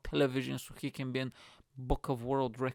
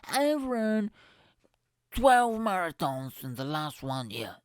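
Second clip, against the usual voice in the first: higher, louder, slowed boasting at the start; mock bragging marathon runner; 6 semitones higher, 7 dB louder, slower than usual. Mocking a marathon runner who brags about his count. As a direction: puff up like a proud runner listing his marathons. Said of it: "Or those people who run the marathons".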